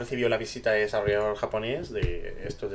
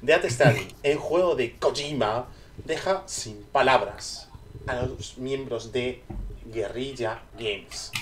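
slow, drawn-out news read; slow dramatic headline; slower than usual. Acted. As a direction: announce each word slowly.

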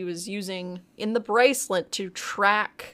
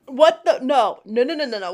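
loud, higher-pitched burst of protest; indignant objection; 5 semitones higher, 7 dB louder than usual. Real, not acted. Co-host stopping partner who skipped the best part, loud protest. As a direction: cut in with a rapid run of loud no's.

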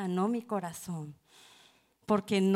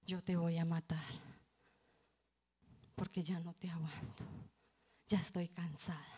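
low, soft, fading to a whisper; hushed threat; 3 semitones lower, 10 dB quieter than usual. Retold or imitated. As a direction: threaten him quietly, worn out. Le dice al tío que lo matará. (amenaza en voz baja y cansada)

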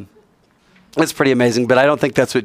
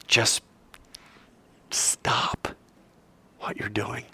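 soft, whispered urging; quiet whispered call to stop; hushed plea; 9 dB quieter, much slower than usual. Retold or imitated. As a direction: drop to a whisper to plead.

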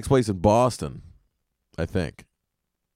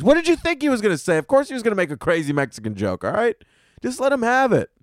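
exasperated rant; 9 semitones higher, faster than usual. Acted. Defending dad's joke.

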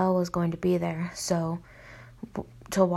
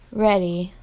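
a touch louder group holler; mild cheer-squad holler; 5 dB louder than usual. A narrator's reading. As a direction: call out ready to the coach. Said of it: "hollered the cheer squad".

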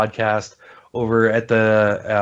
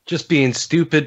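ordinary talk, nothing marked

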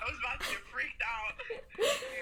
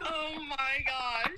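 laughing shriek; 4 dB louder, much slower than usual. Real, not acted. Victim realises who called.

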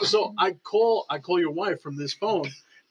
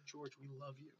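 imitated affection; 6 semitones lower, 31 dB quieter than usual. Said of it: "she's like".

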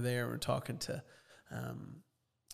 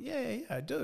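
eager yes; 12 semitones higher, faster than usual. Retold or imitated. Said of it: "And I went".

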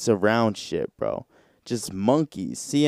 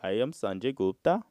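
a light mock Indian accent; Sanjay Gupta Indian accent; 6 dB quieter than usual. Imagining how the doctor should sound, in a mock Indian accent. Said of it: "that's how I think he would sound".

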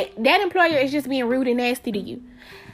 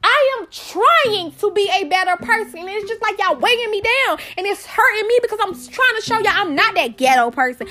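high, pleading frustration; frustrated outburst; 7 semitones higher than usual. Real, not acted. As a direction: plead to be understood. Venting frustration, raised pitch.

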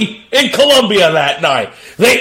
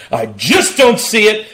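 normal talking voice

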